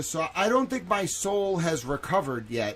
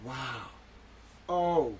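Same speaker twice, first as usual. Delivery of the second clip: ordinary talk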